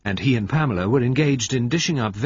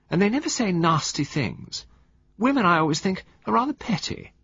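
affectionate murmur; 9 semitones higher than usual. Acted. Valentine flattering her husband, high affectionate coo. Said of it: "murmured affectionately".

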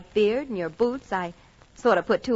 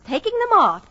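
exasperated; 10 semitones higher, 6 dB louder than usual. Acted. Fed up with dressing kids.

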